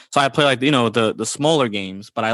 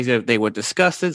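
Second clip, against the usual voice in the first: usual speaking voice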